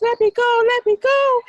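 high, loud desperate cry; panicked pleading. Acted. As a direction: beg frantically to be let go.

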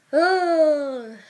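loud, high, long groan; exasperated groan. Real, not acted. Woman starting a rant about models, exasperated groan.

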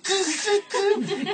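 high, pinched hush through closed lips; between-lips hush. Retold or imitated. Mother silences her son between her lips. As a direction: hiss a shush without opening your lips. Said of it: "El callate entre labios".

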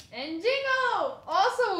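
high, loud, slow cheer; delighted cry at a good pull; excited. Real, not acted. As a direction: call the name out with glee. Excited at getting Jingle card.

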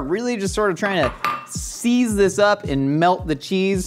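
ordinary delivery

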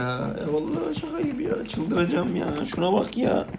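exasperated high-pitched whine at the cheater; squealing disbelief. Real, not acted.

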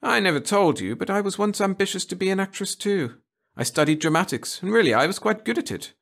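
a prim teacher coaxing her pupil; a hint of a fussy schoolmistress. A narrator's reading. Miss Burton recalls acting ambitions.

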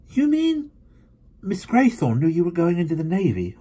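high, loud amazed question; surprised. Acted. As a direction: leap up in disbelief. Younger brother's surprised question.

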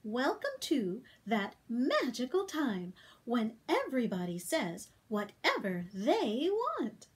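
plain reading voice

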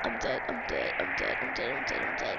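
soft, voiceless repetition; hushed muttering. Real, not acted. Player to self after a scare, hushed mutter. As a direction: say it under your breath.

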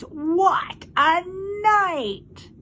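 very slow, high, husky exclamation; husky, drawn-out marvelling. A narrator's reading. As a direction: stretch it out in a husky rat voice. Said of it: "he said in a husky voice".

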